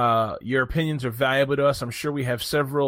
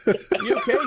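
squeaky mock concern.

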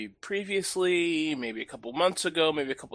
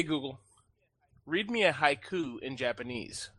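normal talking voice

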